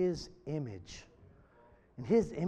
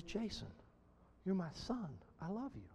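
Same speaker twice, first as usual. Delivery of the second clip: very soft, still small voice; gently soothe, barely above a murmur; calm, tender; 12 dB quieter than usual. Acted. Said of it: "with the calmness in his voice".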